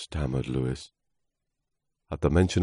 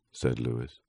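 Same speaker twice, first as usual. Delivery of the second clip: ordinary delivery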